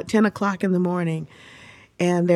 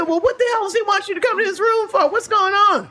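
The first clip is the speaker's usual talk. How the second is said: higher, pressing questions; a protective father grilling his daughter; lightly voiced anxious father; worried dad; 12 semitones higher than usual. Father demanding answers.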